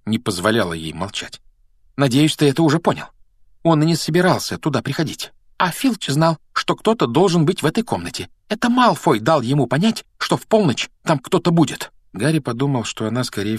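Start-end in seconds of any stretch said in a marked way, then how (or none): 2.0-11.9 high, emphatic explaining voice; insistent, still winded. A narrator's reading. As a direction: lecture him in a raised pitch without waiting to recover. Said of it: "Она ещё не успела отдышаться, но её натура". (запальчиво, высоким голосом)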